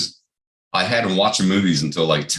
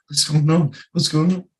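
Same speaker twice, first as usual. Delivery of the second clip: put-on higher, confused kids' voices asking; mock scared kids; 9 semitones higher than usual. Scared sleepover kids wondering about flickering lights, higher confused voice. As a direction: play the puzzled frightened kids in the dark.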